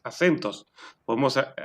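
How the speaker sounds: normal talking voice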